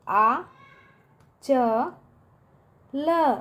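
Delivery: आ, च, ल with long pauses between; syllable-by-syllable demo. Shown as a demonstration. Teacher spells a word for pupils, आ च ल widely separated. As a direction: sound out आ च ल one by one. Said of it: "आंचल इस तरह से लिखेंगे".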